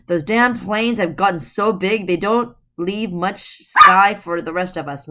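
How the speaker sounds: put-on grumbling old woman. A narrator's reading. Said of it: "Mother Goose said".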